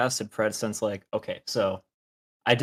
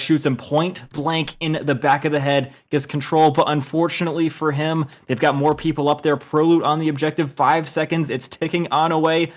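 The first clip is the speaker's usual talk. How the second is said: loud, higher-pitched match commentary building to the call; live esports play-by-play; 6 semitones higher, 8 dB louder than usual. Real, not acted. Caster calls a last-ditch objective fight for viewers, excited play-by-play. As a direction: drive the final seconds home, then ease down on the win.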